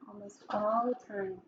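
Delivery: very soft, withdrawn storybook elephant; hushed, reluctant character voice; quiet admission. A narrator's reading. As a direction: repeat it barely aloud, as a shy confession. Elephant's soft answer repeated to children.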